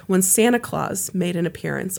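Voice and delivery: ordinary delivery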